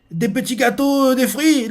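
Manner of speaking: voix haute et ravie; s'extasier devant la nourriture; ravi. Retold or imitated. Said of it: "il fait". (voix aiguë et ravie)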